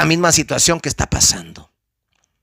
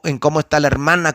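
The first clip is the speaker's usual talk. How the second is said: ordinary delivery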